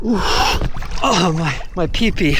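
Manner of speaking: gasping in icy water; shocked by freezing cold water; in ice cold water. Real, not acted. It's cold.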